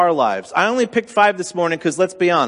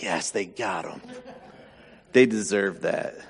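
gloating laugh; 9 semitones lower, 8 dB quieter than usual. Retold or imitated. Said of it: "and you're like".

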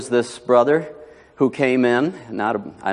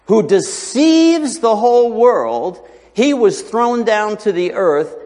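high, slow, loud proclamation; raised preacher's voice, stretched for weight; forceful preaching; 10 semitones higher, 5 dB louder, slower than usual. Real, not acted. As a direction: hammer the verse out slowly from the pulpit.

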